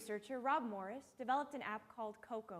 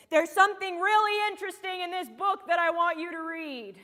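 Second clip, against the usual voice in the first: loud, high, projected voice; emphatic quoted announcement; 7 semitones higher, 10 dB louder than usual. Acted. Sam returning a favorite childhood book, loudly emphatic. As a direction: push it out high and loud to the whole room. Acting Sam's quote from the stage.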